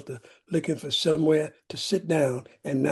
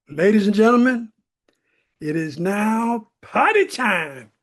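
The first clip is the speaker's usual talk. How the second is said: loud, higher announcer call, slowing on party time; bullhorn announcement; 4 semitones higher, 4 dB louder, slower than usual. Retold or imitated. Alone at home with his football bullhorn, loud announcer call. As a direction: boom it out like through a bullhorn. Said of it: "bullhorn down from the closet".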